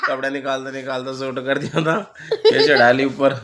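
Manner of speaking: talking through laughter; laughing. Real, not acted. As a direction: laugh your way through the words. बेटे की हरकत पर हँसी. (हँसते-हँसते बोलना)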